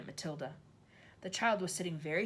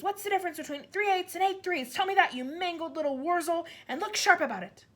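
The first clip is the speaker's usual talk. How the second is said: higher, louder, hammering each insult; furious rant; 11 semitones higher, 7 dB louder than usual. A narrator's reading. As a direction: berate him with contempt. Trunchbull ranting at Wilfred.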